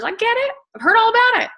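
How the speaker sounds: very high-pitched "yes, I know"; exaggerated acknowledgment. Real, not acted. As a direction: pitch it way up, waving away complaints. Brushes off back office complaints.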